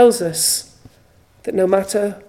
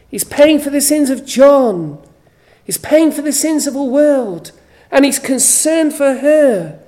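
louder, higher impassioned preaching; preaching at full force; 4 semitones higher, 7 dB louder than usual. Acted. Preacher to his Mother's Day congregation, impassioned and louder. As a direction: proclaim it from the pulpit with fervour. Preacher rises to cross scene.

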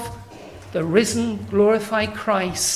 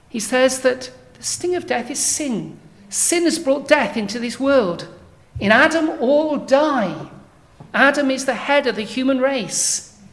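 raised, louder pulpit voice driving each line home; fervent preaching; 3 semitones higher, 3 dB louder than usual. Acted. Preacher warning the congregation about sin and death, fervent and loud.

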